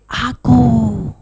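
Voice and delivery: soft, drawn-out hushed call; quiet call to the audience. Shown as a demonstration. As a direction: call it quietly, letting GO trail. Varied call for audience response.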